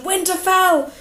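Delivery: rallying shout; battle shout. A narrator's reading. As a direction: shout the house name into the charge. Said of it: "Sir Roderick shouted".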